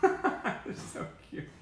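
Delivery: slow, high coo through a laugh. Real, not acted. Fan giggling over baby pictures, high and slow. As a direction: giggle while gushing.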